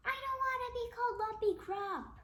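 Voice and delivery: high, fast protesting shout. Acted. Principal shouting at the professor in protest. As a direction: yell it out, high and quick.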